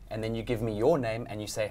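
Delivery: usual speaking voice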